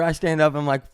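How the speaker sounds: ordinary talk, nothing marked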